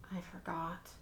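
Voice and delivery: guilty whispered aside; sheepish hushed whisper. A narrator's reading. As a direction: whisper it like an admission.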